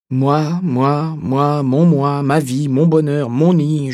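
loud, high, slow and drawn out; emphatic drawn out. Acted. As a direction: stretch each word out loud and high. Straining to grasp the self.

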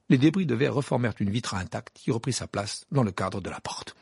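usual speaking voice

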